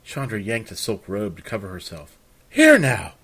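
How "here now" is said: startled outcry. Acted. Chandra startled by intruder.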